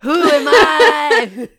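very loud, slow rapper holler. Acted. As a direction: shout it out like a hype man, laughing. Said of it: "I just turn into [name]".

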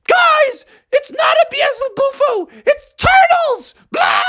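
very high shrieking warning; panicked frog. Acted. Froggy warns friends about the turtles, shrieking. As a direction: squeal it up to the guys, ending in a cartoon yelp.

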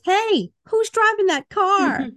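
high, slow called-out quote; character voice. Retold or imitated. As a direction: drawl it like a cartoon character. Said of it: "The next one is".

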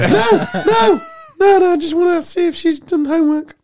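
put-on very high, flustered boy voice; panicking schoolboy. Boy pleading with the girl's dad, high and flustered. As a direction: squeak the denials in a panic.

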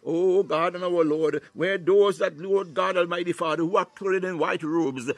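normal talking voice

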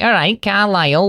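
high-pitched mock Cumbrian; Cumbrian accent. Co-host imagining the actor's home speech, mock Cumbrian accent. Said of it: "grew up speaking fucking Cumbria".

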